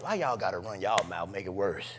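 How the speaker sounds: low, soft grumble; retold aside to himself; muttered complaint. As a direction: complain under your breath. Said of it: "I'm like".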